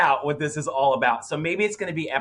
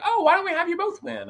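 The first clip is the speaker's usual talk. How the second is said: high mock judge; 13 semitones higher than usual. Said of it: "they're like".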